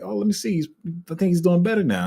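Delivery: high, hopeful retold thought. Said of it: "I was like".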